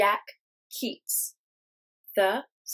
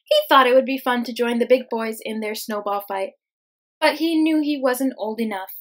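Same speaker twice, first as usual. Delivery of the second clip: put-on lively, louder read-aloud for kids; reading to children; 10 dB louder than usual. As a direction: tell it warmly and brightly.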